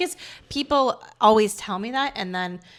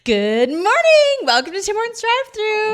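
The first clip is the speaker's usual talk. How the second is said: much higher, louder cheerful greeting; peppy drive-thru greeting; 11 semitones higher, 6 dB louder than usual. Retold or imitated. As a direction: chirp it like a happy cashier. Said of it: "answer the drive-thru like that".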